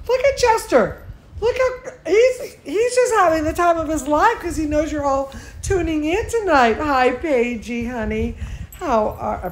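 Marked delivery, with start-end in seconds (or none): 0.1-6.8 high, loud delight; excited gushing. Real, not acted. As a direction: point out the pet's antics in a high, thrilled voice. Gushing over Chester having fun.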